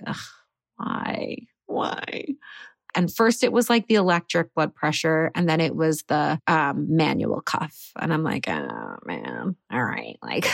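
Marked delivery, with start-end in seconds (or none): 0.1-2.3 breathy, reluctant whisper; a mock-exhausted whispered why; weary whispered groan. Groaning at another blood pressure check. 8.5-10.5 much lower, dragging mock groan; imitating her own glum resignation; deflated groan. Dreading the manual cuff.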